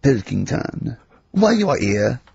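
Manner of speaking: mock French emperor; French accent. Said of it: "I don't know why he's French".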